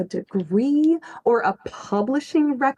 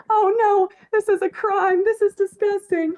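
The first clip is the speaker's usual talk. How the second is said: mock horrified chat; 8 semitones higher than usual. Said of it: "Chat was popping off with".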